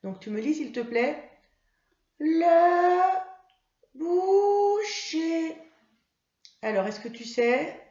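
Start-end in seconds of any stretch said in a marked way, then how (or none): 2.2-5.6 put-on much higher, louder, slow child puppet voice; Mimi. Mimi the puppet answering her teacher, high child voice. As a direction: answer slowly like an eager little puppet.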